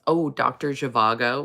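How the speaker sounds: ordinary delivery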